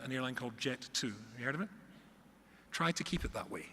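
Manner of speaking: ordinary talk, nothing marked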